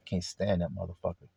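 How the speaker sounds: low, soft private grumble; secret inner thought. Acted. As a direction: say it quietly to yourself. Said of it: "but in your heart you said".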